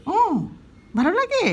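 high, loud cooing question to a pet. Real, not acted. Asking her pet if it likes her lap, high cooing.

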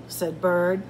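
ordinary delivery, nothing marked

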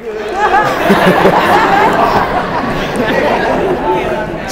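breathy high laugh; real amused laughter; high giggle. Real, not acted. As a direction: laugh it off, tickled. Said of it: "I'm like".

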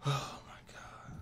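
slow, breathy whispered oh my God; whispered disbelief. Real, not acted. Gamer stunned after getting killed from a corner.

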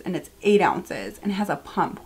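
ordinary delivery

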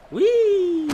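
squealing high and loud with glee; giddy high-pitched whee; gleeful whee. Real, not acted. Playful cry while jumping.

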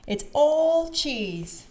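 high, slow exclamation; amazed. A narrator's reading. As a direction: stretch it out in delighted wonder. Peppa amazed by the view.

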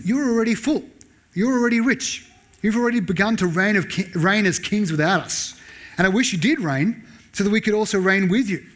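high, mock-admiring sarcasm; sarcastic. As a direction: flatter them so they hear the jab. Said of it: "here comes the sarcasm".

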